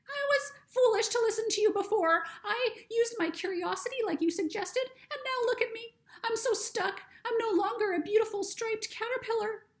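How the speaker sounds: a tiny high creature, frightened and stuck; butterfly voice. Acted. Butterfly speaks in story.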